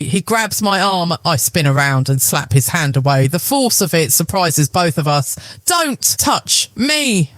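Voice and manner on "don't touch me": slow, sharp hiss. A narrator's reading. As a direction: spit the words out. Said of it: "I hiss".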